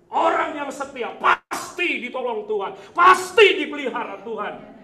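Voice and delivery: loud, emphatic exhortation; full-force preaching. Real, not acted. Preacher assuring the congregation of God's care, loud forceful declaration. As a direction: punch key words with booming conviction.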